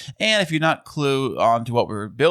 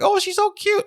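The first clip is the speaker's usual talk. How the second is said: much higher cooing voice; drive-thru guy voice. Retold or imitated. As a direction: coo it like a charmed cashier. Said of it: "And the guy at the counter was like".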